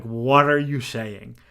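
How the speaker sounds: loud, slow, scandalised demand; outraged old lady. Retold or imitated. Old Italian lady at the basilica, loud scandalised scolding. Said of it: "lost her mind".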